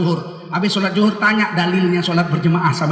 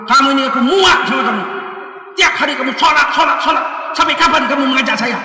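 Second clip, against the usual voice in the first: loud, higher fed-up outburst; angry retort; 5 semitones higher, 5 dB louder than usual. Retold or imitated. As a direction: bark it like an exasperated colleague. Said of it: "Sampai dia marah".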